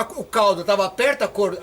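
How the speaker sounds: usual speaking voice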